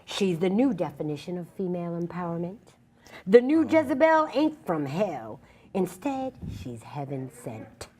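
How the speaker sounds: slow, declamatory, proud recitation; spoken-word poem. Acted. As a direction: stretch key words and lift them defiantly. Continues performing her poem.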